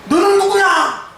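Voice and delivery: high, slow demanding challenge; the evil spirit sneering down at them; menacing demon. Retold or imitated. Demon challenges Sceva's sons. (귀신의 위협적인 목소리)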